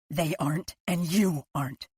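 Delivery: ordinary delivery, nothing marked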